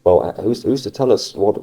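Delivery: ordinary talk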